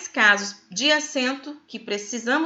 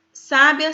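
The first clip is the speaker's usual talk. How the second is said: "sábia" with a loud SÁ; stress on first syllable; 3 semitones higher, 6 dB louder than usual. Shown as a demonstration. Teacher listing the three words, "sábia" stressed loudly on SÁ. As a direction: say "sábia" as SÁ-bia. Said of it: "o acento é no A".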